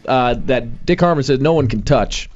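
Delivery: normal talking voice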